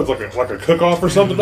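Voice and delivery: ordinary talk, nothing marked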